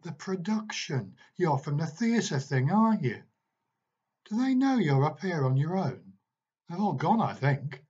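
a stern teacher cross-examining a lad; irritated teacher. A narrator's reading. Marley voiced, teacher questioning boy.

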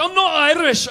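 loud, high Scottish indignation; Scottish accent. Retold or imitated. Scot refusing to be called Irish, Scottish accent. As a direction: object loudly in a Scottish accent. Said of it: "don't call them Irish".

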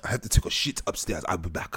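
low, soft put-on French accent; mock French accent. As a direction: announce it in a fake French accent. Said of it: "If you say it with that accent".

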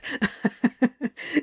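rising breathy giggle; giggling fit. Acted. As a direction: burst into giggles. Laugh burst.

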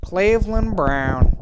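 slow, drawn-out cartoon character impression; Cleveland Brown. Podcaster trying a cartoon impression for listeners, drawn-out character voice. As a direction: stretch the name out in a put-on voice. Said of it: "this impression of me doing Cleveland".

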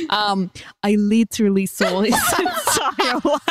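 mock Russian accent breaking into laughter; badly faked Russian, cracking up; terrible Russian accent. Reading the confession as a Russian parent, laughing through a bad accent. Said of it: "a really terrible accent".

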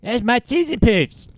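cartoon kid voice.

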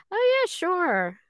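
high, slow, eager yes; eager agreement. Retold or imitated. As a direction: chirp it up brightly to the director. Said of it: "And I went".

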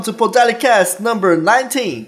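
lightly put-on American accent in Portuguese mouth; English read with a hint of a gringo accent. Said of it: "eu falo com sotaque de gringo".

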